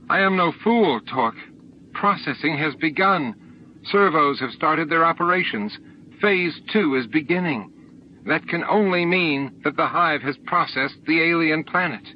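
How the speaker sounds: high, heated confrontation; angry accusation. A narrator's reading. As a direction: confront him with what you know. Furious woman accuses Torque.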